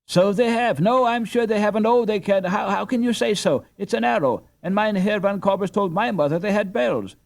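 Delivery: put-on high, loud clamour of eager children; many kids talking over each other, worked up; excited chorus, high and loud; excited group chorus. A narrator's reading. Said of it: "came from the excited group".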